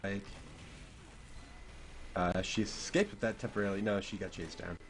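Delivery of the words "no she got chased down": normal talking voice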